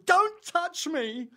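slow, high, loud mock shriek; hammed-up indignant scream; outraged shriek. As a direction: imitate a woman shrieking in outrage. Imitating woman outraged at a touch.

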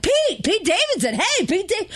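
high-pitched, over-eager hail; mock excited greeting. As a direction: imitate castmates gushing over a sudden celebrity. Imitating suddenly friendly castmates.

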